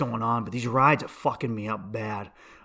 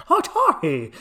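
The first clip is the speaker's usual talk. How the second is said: higher, mock Chinese accent; badly faked Chinese accent, pitched up; 8 semitones higher, 4 dB louder, slower than usual. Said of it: "he just did a Chinese accent".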